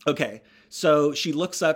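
ordinary talk, nothing marked

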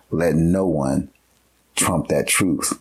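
"Let no one" then a pause, then "trump that truth" slow; slow, spaced emphasis. Real, not acted. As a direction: lay each word down slowly with a pause before "trump". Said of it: "I don't care if they've been".

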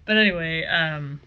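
ordinary talk, nothing marked

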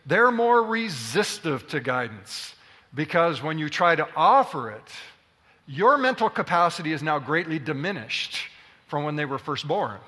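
usual speaking voice

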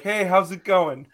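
sweet as can be, disarming; playing the nice guy. Friendly fisherman greeting a dock owner. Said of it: "I'm like the nice guy".

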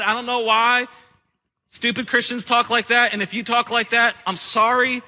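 ordinary delivery

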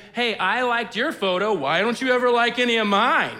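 hammed-up petty grumble; mock whiny complaint. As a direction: parody a needy friend online. Mocking social media whining.

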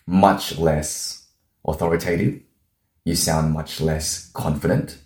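"authoritative" and "confident" rising like questions; upward inflection. Shown as a demonstration. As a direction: lift the ends of "authoritative" and "confident", unsure. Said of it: "with an upward inflection".

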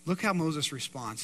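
normal talking voice

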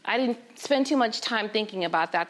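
ordinary delivery, nothing marked

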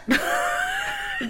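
high squealing laugh. Real, not acted. Friend laughs at her own reaction to a strange word, squealing. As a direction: let a shriek of laughter out.

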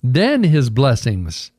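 "then" stretched out, slow phrase; emphasis on THEN. Real, not acted. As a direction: lean on "then" and take it slowly. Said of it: "I emphasize the word then".

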